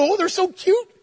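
put-on high gushing baby-animal coo; high-pitched cutesy cooing; cooing over baby animals. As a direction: gush like someone melting over a cute pet. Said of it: "When you see 'em and they're little".

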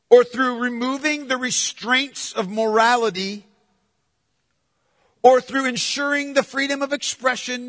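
loud, driving pulpit preaching; preaching at full force. Acted. Preacher urging his congregation about Revelation, loud and driving. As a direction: preach it out to the whole church.

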